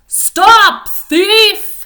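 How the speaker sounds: high, loud shout after a thief; farmer's shout of Stop thief; calling out. Acted. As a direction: yell it across the garden to stop him. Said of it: "calling out".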